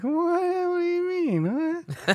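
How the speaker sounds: very high, squeaky mock surprise; feigned innocence. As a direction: play dumb in a falsetto. Feigning surprise at her remark again.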